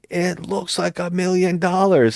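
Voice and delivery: high, slow wide-eyed mock line; mock hero. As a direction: read it like bad movie dialogue. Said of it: "he's like".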